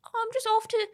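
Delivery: high, hammed-up posh English accent; a playful send-up of posh English speech; mock posh English accent. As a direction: lift it into a silly upper-class English accent. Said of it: "she's got about seven different accents".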